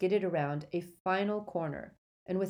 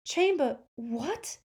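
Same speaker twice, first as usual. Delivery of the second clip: baffled; 9 semitones higher, 3 dB louder, slower than usual. Acted. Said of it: "said Harry".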